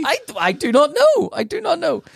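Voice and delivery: high-pitched, emphatic bewilderment; flustered protest. Real, not acted. Comedian answers co-host's teasing question about the nurse, flustered protest. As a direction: insist helplessly, voice jumping right up.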